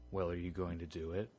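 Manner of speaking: low, soft mock indifference; underwhelmed reaction. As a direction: ask it quietly, as if nothing were strange. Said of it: "his brother's just like".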